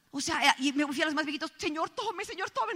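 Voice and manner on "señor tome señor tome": voz llorosa, aguda y suave; llorando. Acted. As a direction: ofrece entre lágrimas fingidas. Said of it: "me moría de llanto yo". (llanto imitado, en voz aguda y baja)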